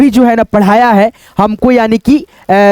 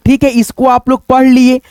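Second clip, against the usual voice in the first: ordinary delivery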